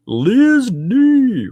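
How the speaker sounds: loud, high name call; excited shout-out. Real, not acted. Host greeting a gifting viewer, excited shout-out. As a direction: hail them high and loud.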